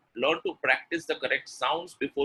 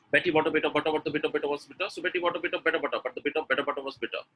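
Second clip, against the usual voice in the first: rapid-fire tongue twister in one run; super fast; faster than usual. Shown as a demonstration. Teacher showing the final practice step, super fast. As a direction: race through it without stopping. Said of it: "really read it super fast".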